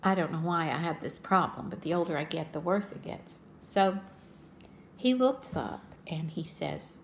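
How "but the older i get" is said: ordinary delivery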